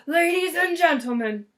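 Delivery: loud formal address; a grand public announcement; Mister Grumps orating. Acted. Mister Grumps addressing the crowd of onlookers, loud and formal.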